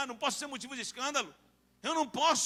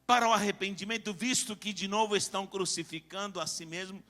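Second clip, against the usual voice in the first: hushed solemn preaching; 5 semitones lower than usual. Real, not acted. Preacher weighing a grave verse for the church, low and hushed. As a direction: let the verse fall to a grave hush.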